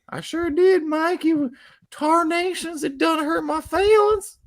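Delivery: high-pitched hammed-up hillbilly twang. Mocking a streamer with a high hillbilly voice. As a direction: squeak it out like a put-upon yokel, slow on tarnations.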